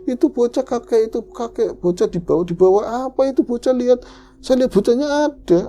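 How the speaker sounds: high, urgent insistence; frantic alarm, retold. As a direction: act out panic over the child. Frantic about the child being taken. (panik mendesak dengan nada tinggi)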